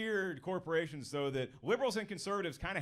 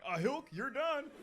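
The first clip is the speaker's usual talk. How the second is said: higher, slow dopey cartoon voice; Goofy voice; 7 semitones higher, slower than usual. Goofy in a smock finishing the vasectomy, slow dopey. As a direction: drawl it like a dim cartoon dog.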